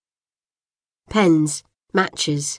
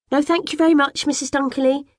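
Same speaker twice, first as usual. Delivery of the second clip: high, prim and very proper; best polite voice; 10 semitones higher, faster than usual. A narrator's reading. Girl refusing the neighbour's tea in her best, prim voice. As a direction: put on your most polite manners. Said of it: "I say in my best voice".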